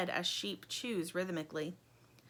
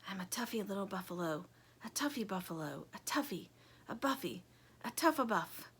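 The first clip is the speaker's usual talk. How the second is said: very soft, building a little by the end; hushed self-encouragement; whispering to himself; 7 dB quieter than usual. A narrator's reading. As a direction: whisper a pep talk to yourself. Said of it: "He whispers to himself".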